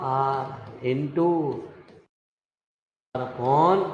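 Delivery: drawn-out formula dictation; slow dictation. Real, not acted. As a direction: spell out the formula as you write. Saying formula while writing it.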